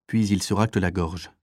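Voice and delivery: ordinary delivery, nothing marked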